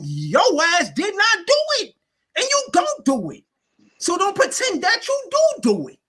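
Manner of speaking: high-pitched, insistent scolding; heated accusation. Real, not acted. Scolding his opponent for pretending, heated and high. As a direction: drive each denial home, voice pitched high.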